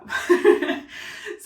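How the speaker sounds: bright high giggle. Real, not acted. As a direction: burst into a giggle. Laughing at own blue curacao.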